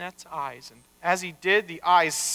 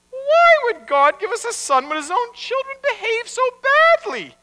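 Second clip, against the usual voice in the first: very high, louder sceptical retort; challenging wife; 5 dB louder than usual. Acted. Wife doubting God would give a son, challenging. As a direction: question it in a high wifely voice.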